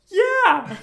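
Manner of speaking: very high, loud squeaky yeah; mock falsetto agreement; silly high voice. Own reply mocked in falsetto.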